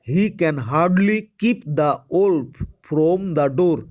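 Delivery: very slow, each word separated; slow dictation. Shown as a demonstration. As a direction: dictate it slowly so students can write. (ধীরে ধীরে শ্রুতলিখন)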